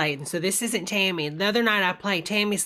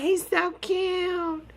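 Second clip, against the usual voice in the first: much higher, slow, doting coo; 11 semitones higher, much slower than usual. Real, not acted. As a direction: melt over the cute kitten. Cooing at the kitten.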